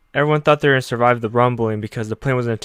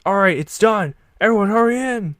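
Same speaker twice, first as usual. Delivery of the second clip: higher shouted summons; urgent call; 9 semitones higher than usual. Retold or imitated. Engineers calling everyone onto the plane, higher urgent shout. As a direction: call them in fast.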